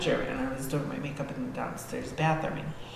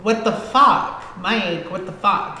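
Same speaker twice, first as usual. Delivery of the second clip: rising, louder outburst of disbelief; retold angry yell; 4 semitones higher, 8 dB louder, slower than usual. She shouts at Mike after being knocked down, angry yell. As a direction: yell at him as if he pushed you.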